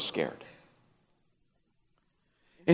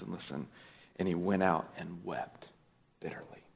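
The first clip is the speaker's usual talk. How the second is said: hushed, solemn Bible reading; solemn reading that sinks to nearly nothing; scripture reading; 5 semitones lower than usual. Acted. Reads Luke twenty-two aloud.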